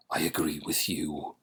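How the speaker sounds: lightly put-on Transylvanian accent; a hint of Dracula's Eastern European accent; soft Transylvanian accent, barely done. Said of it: "That's Dracula's accent".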